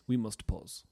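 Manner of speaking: low, soft mock German accent; German Bond villain. Retelling a phone call, imitated German villain accent. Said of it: "sound like a Bond villain".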